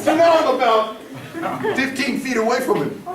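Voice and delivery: a real laugh carried into the words. Real, not acted. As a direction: laugh and keep telling it. Laughing at getting closer.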